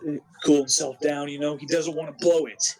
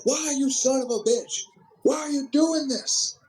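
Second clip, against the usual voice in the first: much higher, anguished commentator wail; Jim Ross impression; 10 semitones higher than usual. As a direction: ham up a distraught announcer pleading. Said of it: "Jim Ross is like".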